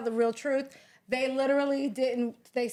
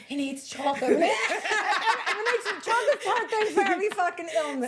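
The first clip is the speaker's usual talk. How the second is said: cracking up over her cure-all; laughing mock of Renee; 9 semitones higher than usual. Teasing Renee about chocolate for everything, laughing.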